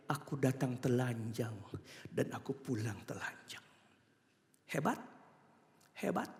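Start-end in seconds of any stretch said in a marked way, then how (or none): 0.1-3.6 lowered voice, unhurried and subdued; resigned Job. Acted. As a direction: speak it softly as humble acceptance. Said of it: "Dia bilang".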